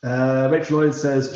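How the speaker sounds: normal talking voice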